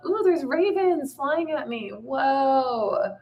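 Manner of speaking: high, drawn-out amazed cooing; startled wonder. Real, not acted. Speaker seeing ravens fly at her, high startled wonder. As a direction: let the voice float up high in surprise.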